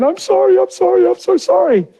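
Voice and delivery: high-pitched frantic apology, imitated; crying wife. Wife running to her husband, mock high tearful pleading. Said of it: "And she's crying".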